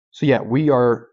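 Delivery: usual speaking voice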